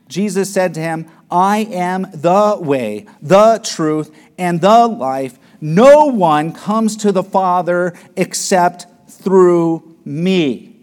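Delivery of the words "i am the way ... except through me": slow, loud, weighty proclamation; slow preached proclamation. Acted. Preacher proclaiming Jesus' claim to Thomas, slow and loud. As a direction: let each phrase land.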